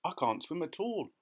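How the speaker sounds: high, mildly panicked; distressed cry. A narrator's reading. Ladybird fearing the water, crying out. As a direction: confess it in alarm. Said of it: "cried the ladybird".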